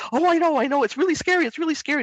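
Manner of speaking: high, loud, alarmed echo of the fear; over-match the child's panic; dramatized worried reassurance; matching the emotion. Shown as a demonstration. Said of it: "it is if you stay there".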